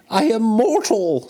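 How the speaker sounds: put-on high, protesting old miser; pleading Scrooge. A narrator's reading. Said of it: "Scrooge remonstrated".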